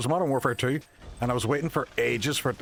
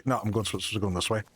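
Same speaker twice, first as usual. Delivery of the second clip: normal talking voice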